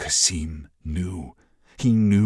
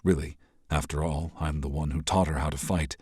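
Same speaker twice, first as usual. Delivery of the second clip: ordinary delivery, nothing marked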